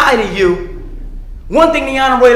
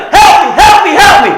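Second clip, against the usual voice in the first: a touch of a yell, pitched up; retold yelling; 5 semitones higher, 4 dB louder than usual. Trapped man calling out, lightly acted yell. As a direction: cry out for help, lightly acted. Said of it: "He's yelling".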